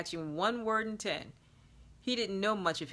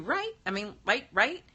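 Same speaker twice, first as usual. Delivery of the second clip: eager; 4 semitones higher, 4 dB louder than usual. Acted. Eager hopeful questions.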